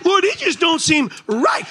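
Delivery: high, loud self-righteous wail; self-righteous rant. Acted. Preacher mocking self-righteous churchgoer, loud high wail. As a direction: wail about how unfair God is.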